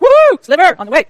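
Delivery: slow, loud, high cheer of delight; happy whoop. Retold or imitated. Ticket sellers cheering their windfall profit, loud and drawn out. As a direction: cheer like a seller who just made a windfall. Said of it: "They're like".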